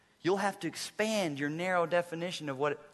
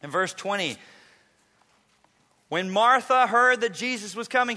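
ordinary delivery

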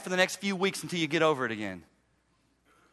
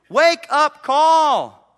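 each word stretched out, louder and higher; slow emphatic call; 7 semitones higher, 8 dB louder, much slower than usual. Acted. Pastor drives home the wake-up call to the congregation, slow and loud. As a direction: lean hard on every word. Preacher hammering his point home.

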